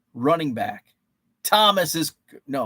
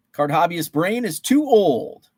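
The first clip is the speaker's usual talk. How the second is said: ordinary talk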